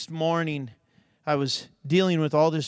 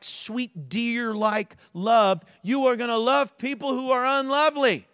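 ordinary delivery

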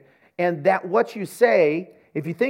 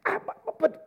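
flustered; 4 dB quieter than usual. Retold or imitated. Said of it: "And I'm like".

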